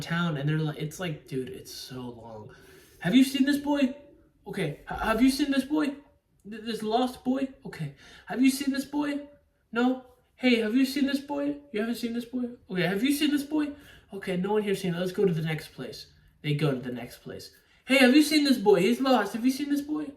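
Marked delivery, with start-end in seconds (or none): 3.0-16.1 higher-pitched, repetitive mock questioning; mock search party, pitched up; mimicking searchers. Mimics repetitive search around town. 17.8-20.1 loud, high mock calling out; exaggerated pleading searcher, loud and high; mock urgent searching. Mimics the searchers asking once more.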